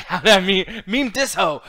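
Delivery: laughing while talking. Real, not acted. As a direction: giggle through the words. Laughing echo of joke.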